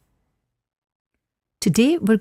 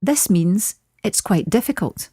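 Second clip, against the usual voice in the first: ordinary talk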